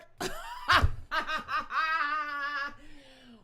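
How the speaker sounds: loud real laughing fit; laughing hard at the viewer's comment; big laugh. Real, not acted. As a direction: crack up for real. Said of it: "thank you for making me laugh".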